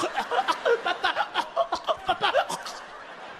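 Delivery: high sputtering coughing gasp; a kid choking on the ground; hammed-up choking wheeze; choking child gasp. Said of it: "He's like".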